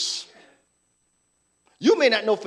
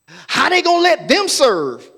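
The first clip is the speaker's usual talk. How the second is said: mock outrage; 4 semitones higher, 7 dB louder than usual. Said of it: "Every time somebody".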